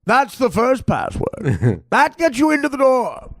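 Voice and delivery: slow, high-pitched mock sinister voice; creepy doorman. The Papa John's guy at a secret party door, slow and creepy. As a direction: drag it out like a creepy gatekeeper.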